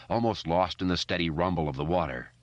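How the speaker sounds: plain reading voice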